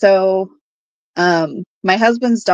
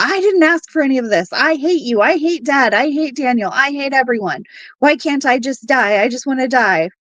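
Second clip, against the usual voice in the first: higher-pitched retold outburst of a distraught child; upset daughter's outburst; 5 semitones higher than usual. Daughter lashing out at her mother in the driveway, retold distraught outburst.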